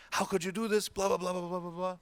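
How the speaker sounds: high, loud rebuke trailing into blah blah; upset scolding. Retold or imitated. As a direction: scold him, pitched well up. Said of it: "He got upset at me".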